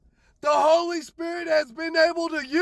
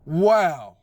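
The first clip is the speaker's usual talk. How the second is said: ordinary talk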